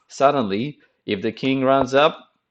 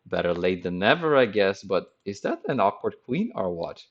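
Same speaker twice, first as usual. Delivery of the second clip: ordinary talk, nothing marked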